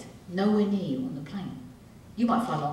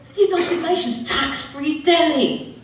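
mock surprise; 8 semitones higher, 5 dB louder than usual. Acted dialogue, surprised.

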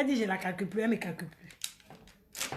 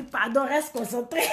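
ordinary talk

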